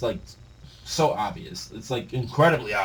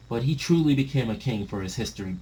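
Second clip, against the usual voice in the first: ordinary talk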